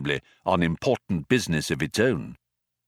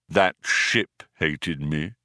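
very slow, low and mournful; dejected; 6 semitones lower, much slower than usual. A narrator's reading. Marvin pointing at the police craft, dejected and very slow. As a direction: drag it out, utterly defeated. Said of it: "he said dejectedly".

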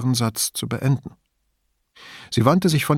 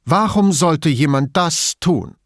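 high, louder indignant question; Entrüstung; 6 semitones higher, 5 dB louder than usual. A narrator's reading. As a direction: try hard to sound outraged. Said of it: "noch einmal mit Entrüstung". (entrüstet, mit erhobener Stimme)

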